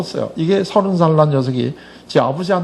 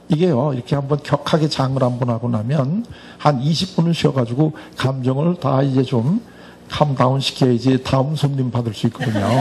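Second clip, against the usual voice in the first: ordinary delivery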